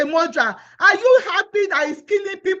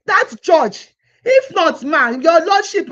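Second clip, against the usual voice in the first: tearful plea; 7 dB louder, slower than usual. Real, not acted. Pleading, breaking into tears.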